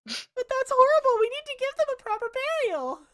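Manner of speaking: very high, loud, appalled protest; shocked pious villager. Acted. The pious villager protests to the party, high and appalled. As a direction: gasp out your outrage in a high voice.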